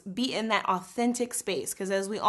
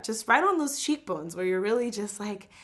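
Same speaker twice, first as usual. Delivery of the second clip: ordinary talk, nothing marked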